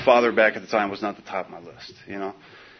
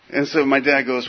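ordinary delivery

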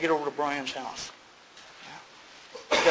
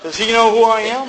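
much higher, louder indignant outburst; how dare he, full of self-importance; pissed off; 7 semitones higher, 13 dB louder than usual. Retold or imitated. Angry newcomer fuming about his sponsor, high, loud. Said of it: "I'm getting real pissed".

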